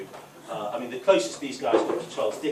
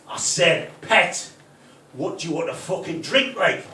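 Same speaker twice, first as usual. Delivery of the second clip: louder insistent repeat in a fake Geordie accent; stupid put-on accent; 3 semitones higher, 7 dB louder, slower than usual. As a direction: bark it in a hammed-up Geordie accent. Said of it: "put on that stupid accent".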